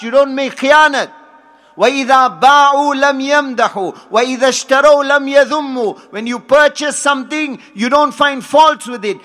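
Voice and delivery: full-force sermon delivery; fervent preaching. Acted. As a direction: preach it out to the hall with fire.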